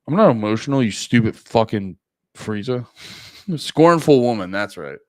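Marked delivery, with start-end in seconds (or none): 0.1-2.9 hammed-up angry denial. Mock angry denial to cohost.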